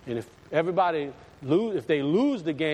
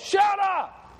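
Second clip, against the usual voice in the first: sudden high-pitched yell; yelling at a dog; 4 dB louder than usual. Retold or imitated. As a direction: holler it out the door at a noisy dog. Acts man yelling at barking dog.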